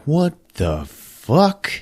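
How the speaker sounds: slow, high, stretched-out disbelief; mock bewilderment. Wife baffled by husband's story, slow incredulous question. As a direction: draw each word out, utterly baffled. Wife hears his strange account.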